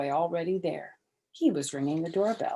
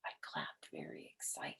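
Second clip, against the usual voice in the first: whispering; 14 semitones lower, 18 dB quieter than usual. A narrator's reading. Whispered narration.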